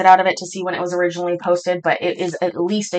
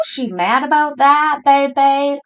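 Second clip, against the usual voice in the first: normal talking voice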